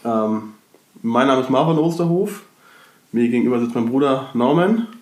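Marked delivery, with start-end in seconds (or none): none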